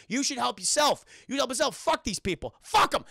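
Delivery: mock aggressive outburst, jumping up at the end. Penguin tells Olive to stop helping people, aggressive. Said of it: "you don't have to be so aggressive".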